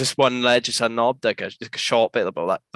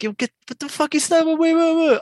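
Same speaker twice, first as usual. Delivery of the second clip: much higher, urgent retold shout; high alarmed yell; 3 dB louder than usual. Skater yelling as the guy comes at him, high alarmed shout. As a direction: stop him in panic.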